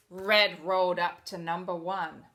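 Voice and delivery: ordinary delivery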